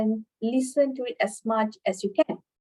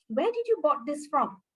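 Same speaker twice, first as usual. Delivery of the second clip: said in one unbroken flow, pitched higher; confident fluent demo, raised pitch; fluent delivery; 4 semitones higher than usual. Shown as a demonstration. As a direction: run the question together without stopping. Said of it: "I can fluently say this".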